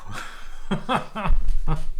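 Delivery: airless laugh falling away; rueful whispered chuckle; breathy whispered laugh. Real, not acted. Laughs after mouse slip.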